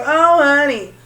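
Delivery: a hammed-up sassy brush-off; sassy disbelief. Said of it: "Someone was like".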